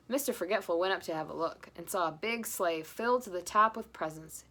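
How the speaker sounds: plain reading voice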